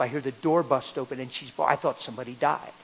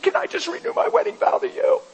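much higher, rising tearful proposal; tearful question; 6 dB louder than usual. Retold or imitated. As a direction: ask it through tears. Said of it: "she's bawling and I'm bawling".

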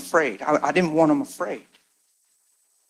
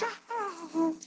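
soft high coo; a tiny, soft, high coo; 12 semitones higher, 16 dB quieter than usual. Retold or imitated. Daughter greeted by her mother, a soft high coo.

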